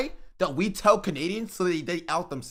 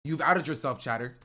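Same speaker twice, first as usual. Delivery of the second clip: normal talking voice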